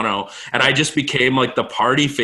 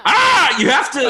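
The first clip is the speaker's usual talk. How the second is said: mock yell; 14 semitones higher, 5 dB louder than usual. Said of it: "and then I'm like".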